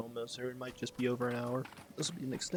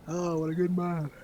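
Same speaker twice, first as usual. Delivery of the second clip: loud, higher put-on voice; mock character voice; 6 semitones higher, 5 dB louder, slower than usual. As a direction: ham up a game character. Mocking the game character.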